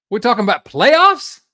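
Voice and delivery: high, loud, incredulous outburst; shouted mock-indignant question; mock viral sports rant. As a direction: imitate a coach's outraged disbelief. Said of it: "dumb viral sports-related moments".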